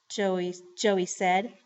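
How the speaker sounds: ordinary delivery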